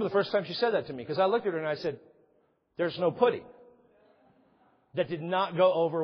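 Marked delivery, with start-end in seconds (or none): 2.8-3.4 mild sarcasm. Retold or imitated. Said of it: "a little more sarcastic tone".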